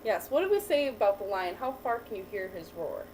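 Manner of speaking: ordinary delivery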